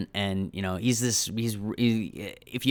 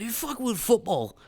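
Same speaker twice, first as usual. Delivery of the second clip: put-on much higher Scottish accent; 13 semitones higher than usual. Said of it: "Like that".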